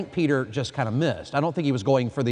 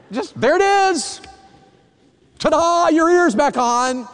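playful Jesus; 12 semitones higher, 7 dB louder, slower than usual. Retold or imitated. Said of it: "if he did the boop".

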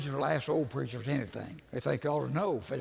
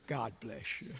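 soft, low, calm blessing; calm wife; 8 semitones lower, 10 dB quieter than usual. Retold or imitated. Calm wife answering his complaints, soft and low. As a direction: say it gently, like nothing bothers you. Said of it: "She just says".

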